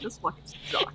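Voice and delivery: muttered aside. Retold or imitated. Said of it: "I'm like".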